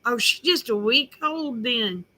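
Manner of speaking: ordinary delivery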